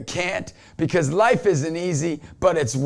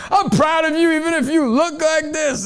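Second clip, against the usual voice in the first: preacher mode; 12 semitones higher, 5 dB louder than usual. Retold or imitated. New dad addressing his baby, in loud preacher mode. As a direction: proclaim it as if to a packed event. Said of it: "so I went into preacher mode".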